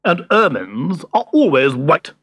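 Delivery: loud, indignant character voice; snappish. A narrator's reading. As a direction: snap the point home, affronted. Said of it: "he snapped".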